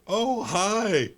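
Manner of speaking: high, sing-song nosy neighbor; nosy small town gossip. Retold or imitated. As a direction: chirp it like a prying busybody. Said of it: "the voice of a nosy neighbor".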